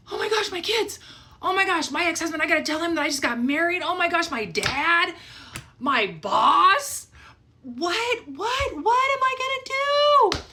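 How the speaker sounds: spiralling into panic, voice climbing with each thought. Retold or imitated. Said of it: "these feelings start hitting me like".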